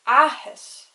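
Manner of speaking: "ahas" with a long, stressed first A; emphasis on first A. Shown as a demonstration. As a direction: lean hard on the opening vowel of "ahas". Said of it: "I put a lot more emphasis on the first".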